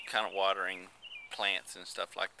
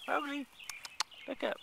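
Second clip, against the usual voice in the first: much higher coaxing pet voice; high call to cat; 3 dB quieter than usual. Real, not acted. Calling the cat.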